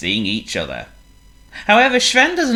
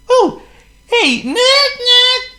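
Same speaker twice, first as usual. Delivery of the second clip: high, loud, drawn-out hello; excited greeting; 8 dB louder, much slower than usual. A narrator's reading. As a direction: call out happily to a friend. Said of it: "Sven greeted Waldo".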